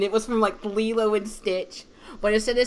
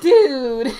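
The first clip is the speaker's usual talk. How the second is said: loud exasperated dude; 4 semitones higher, 11 dB louder than usual. Retold or imitated. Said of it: "I'm like".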